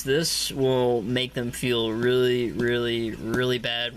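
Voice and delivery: drawn-out really, really, really; slow teasing drawl. Real, not acted. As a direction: stretch it out slowly with glee. Teasing the opponent's misplay.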